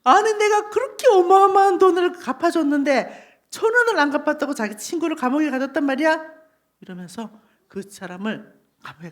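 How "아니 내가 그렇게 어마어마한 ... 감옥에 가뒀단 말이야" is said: loud, high outrage, easing off; angry king. Acted. King scolds the forgiven servant who jailed his friend, angry. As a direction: rail indignantly as an offended king. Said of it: "왕이 화가 났어요".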